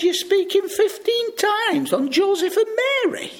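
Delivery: put-on quoted girl. Spanish girl quoted on bus.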